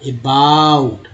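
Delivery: अबाउट drawn out slowly, a-baa-ut; slow model word. Shown as a demonstration. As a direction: stretch अबाउट for learners. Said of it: "अबाउट में ओ को ए बा".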